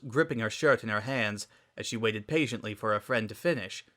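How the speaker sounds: ordinary delivery, nothing marked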